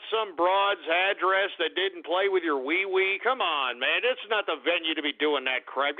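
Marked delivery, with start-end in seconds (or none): none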